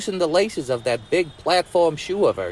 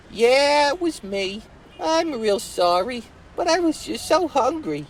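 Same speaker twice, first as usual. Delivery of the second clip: put-on much higher, contrite girl, slowing on the apology; apologetic Betsy voice; 10 semitones higher than usual. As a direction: plead sorry in a high small voice. Betsy confesses, voiced figure.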